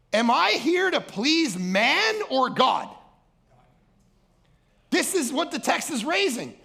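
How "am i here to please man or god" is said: slow, high, louder emphatic question. Real, not acted. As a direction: drag it out to make them think. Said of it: "And I'm going".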